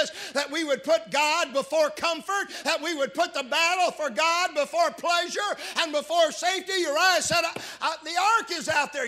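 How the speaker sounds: loud, high preaching cry; fiery pulpit delivery; full-force preaching. Acted. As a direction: preach it out to the back pews.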